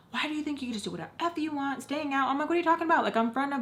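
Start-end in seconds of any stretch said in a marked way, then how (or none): 0.1-2.3 fast, high scolding mom impression; mom blowing up. Mom scolding kid for staying out, fast angry mimicry. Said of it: "goes from zero to a hundred". 2.5-3.6 loud, high protest, retold; exasperated retort. Kid defending self to mom, loud exasperation. Acted phone quarrel with mom.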